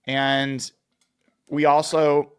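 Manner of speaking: frog in throat before a clear; words caught on a frog in throat. Real, not acted. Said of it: "Let me clear my throat".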